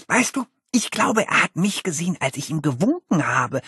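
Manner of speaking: high, gushing seventh-grader; eager boy. Acted. Seventh-grader gushing to his friend about Cody. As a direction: bubble over about your hero.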